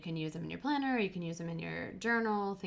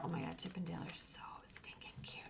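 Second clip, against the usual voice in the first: quick excited whisper; rushed delighted whisper; 11 dB quieter, faster than usual. Real, not acted. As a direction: whisper it fast, thrilled. Whispering over stickers.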